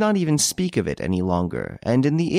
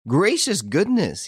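laughing exclamation; 10 semitones higher, slower than usual. A narrator's reading. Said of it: "he exclaimed, laughing".

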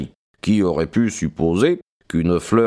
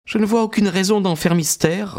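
higher, lightly voiced young woman; young woman's voice; 10 semitones higher than usual. A narrator's reading. Maud speaking to Stackhurst, composed young woman's voice.

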